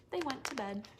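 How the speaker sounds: usual speaking voice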